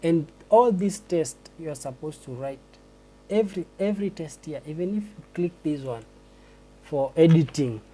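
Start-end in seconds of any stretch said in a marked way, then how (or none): none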